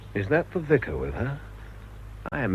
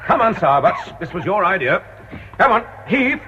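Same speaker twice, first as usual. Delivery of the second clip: irritated; 6 semitones higher, 5 dB louder than usual. Acted. Straining at crowbar, irritated.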